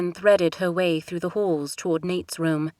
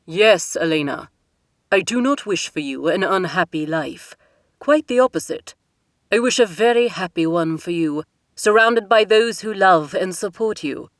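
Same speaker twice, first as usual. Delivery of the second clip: louder, firm royal father; a king laying down the law to his daughter; stern king; 7 dB louder than usual. Acted. King's voice held by narrator.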